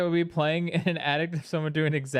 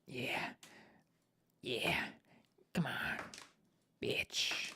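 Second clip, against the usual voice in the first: whispering; 7 semitones lower, 13 dB quieter, much slower than usual. Real, not acted. Cheering on noisy neighbors at night.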